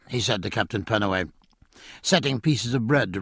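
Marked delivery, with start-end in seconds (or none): none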